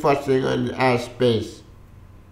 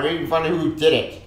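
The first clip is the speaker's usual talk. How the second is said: ordinary talk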